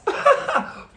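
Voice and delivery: high, loud burst of laughter; real laugh. Real, not acted. Storyteller amused at his own stupid question, real laughing. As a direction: crack up at your own blunder.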